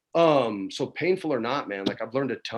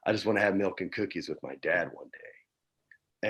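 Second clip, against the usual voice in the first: choking up; 5 semitones lower, 7 dB quieter than usual. Real, not acted. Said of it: "oh, I'm gonna cry".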